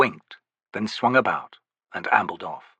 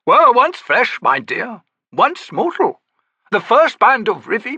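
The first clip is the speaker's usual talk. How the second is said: lofty, theatrical, higher; grand old Daru; 13 semitones higher, 6 dB louder than usual. Acted. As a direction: play the eccentric old trickster. Cropper reply.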